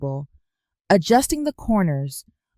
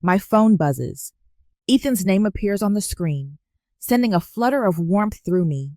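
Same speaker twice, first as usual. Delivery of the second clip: plain reading voice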